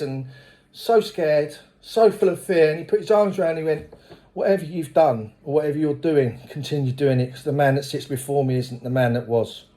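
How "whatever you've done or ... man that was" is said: a touch of a tender sponsor. Retold or imitated. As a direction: speak it like a hug. Sponsor's embrace, retold.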